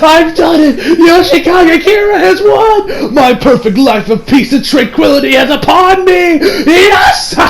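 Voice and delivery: cackling, high-pitched gloating; hammed-up villain victory speech with mad laughter; maniacal villain triumph. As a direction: crow it ecstatically, then cackle.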